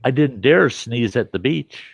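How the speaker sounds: usual speaking voice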